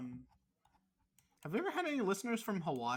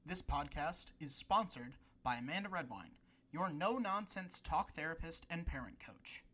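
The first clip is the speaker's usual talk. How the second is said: soft, measured sponsor read; 4 dB quieter than usual. Acted. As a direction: read the sponsor spot calmly. Reads sponsor ad.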